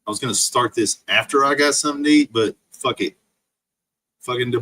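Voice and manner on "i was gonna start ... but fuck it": normal talking voice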